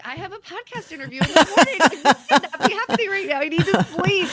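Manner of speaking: high, fast mock-frantic plea breaking into laughter; retold exasperated panic. Woman replaying her sleepless night before an interview, mock frantic. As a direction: rush it out high-pitched, half laughing.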